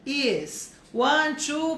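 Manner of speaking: very slow, clear dictation; slow model answer. Shown as a demonstration. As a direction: dictate it slowly. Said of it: "vai explicar pra ela".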